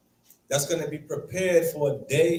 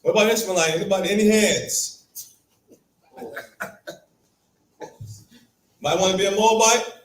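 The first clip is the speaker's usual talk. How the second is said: raised, louder mock invitation; playfully canvass the room for takers; pitched-up teasing question; teasing offer; 4 semitones higher, 4 dB louder, faster than usual.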